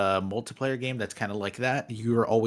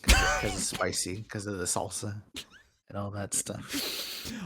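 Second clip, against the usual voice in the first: put-on Spanish accent; 3 semitones lower, 5 dB quieter than usual. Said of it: "That was me trying to do a Spanish accent".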